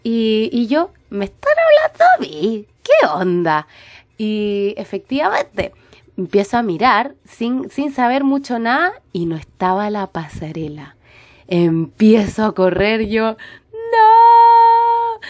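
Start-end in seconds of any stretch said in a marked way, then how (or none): 1.1-3.7 can't believe they mean you; surprised. Retold or imitated. Confused she's being called. 13.7-15.2 high, loud wailing no; desperate cry. Retold or imitated. Narrator running for the departed ship, a loud high cry.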